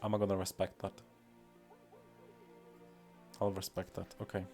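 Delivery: normal talking voice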